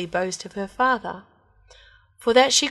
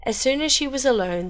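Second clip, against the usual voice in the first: ordinary delivery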